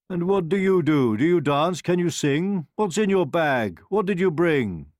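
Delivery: eager royal cross-examination; demanding questioning. A narrator's reading. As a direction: fire questions at a newcomer. Royals quiz the old woman.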